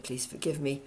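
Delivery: usual speaking voice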